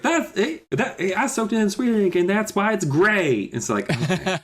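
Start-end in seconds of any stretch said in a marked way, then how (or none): 0.0-3.4 high put-on character voice; Alex. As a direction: explain it in a high cartoonish voice. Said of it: "Alex is like".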